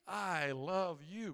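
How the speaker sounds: very slow, drawn-out mock affection; overdone, slow-motion sweetness to another driver; overcompensating friendliness. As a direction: stretch every word in exaggerated friendliness. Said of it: "I probably overcompensate".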